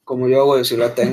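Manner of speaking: showing off a thick deep voice; mock deep voice. Shown as a demonstration. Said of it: "tienen la voz gruesa".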